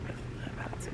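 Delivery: hushed whisper to camera; breathy whispered aside. Real, not acted.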